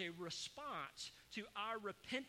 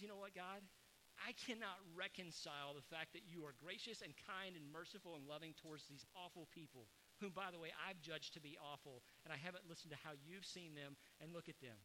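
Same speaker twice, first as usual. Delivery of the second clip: muttered grievance; 4 semitones lower, 8 dB quieter than usual. Acted. Jonah's quiet grumble.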